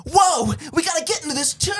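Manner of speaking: put-on high little-boy American voice; mock boyish American accent, high and eager; Tom Holland. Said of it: "a little boy American accent".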